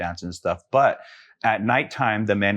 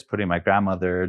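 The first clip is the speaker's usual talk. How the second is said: ordinary talk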